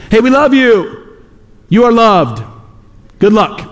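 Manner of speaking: high, airy, dismissive cheer; mock breezy well-wishing. Preacher mocking churchgoers who only talk love, high breezy cheer.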